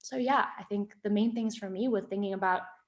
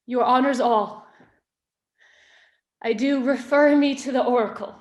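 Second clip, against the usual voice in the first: wronged queen defying her accuser, loud and impassioned; Hermione on trial; 2 semitones higher, 6 dB louder than usual. Acted. Accused queen answering her husband before the nobles, impassioned defiance. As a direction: plead the case in weighty, deliberate heightened verse, with long pauses.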